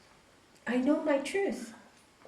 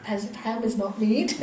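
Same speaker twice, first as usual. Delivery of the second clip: ordinary talk, nothing marked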